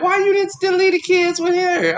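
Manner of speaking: high, lightly voiced mom; complaining mother. Mother on the phone complaining, high voice. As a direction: question him in disbelief. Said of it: "she was like".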